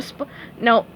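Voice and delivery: usual speaking voice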